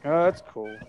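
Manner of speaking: ordinary talk, nothing marked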